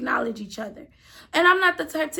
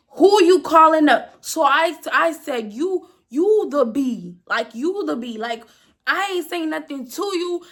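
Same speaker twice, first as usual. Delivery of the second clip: loud, heated challenge; confrontational; 2 semitones higher, 5 dB louder than usual. Retold or imitated. Cashier squaring up to a customer who insulted her, heated challenge. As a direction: square up and call them out.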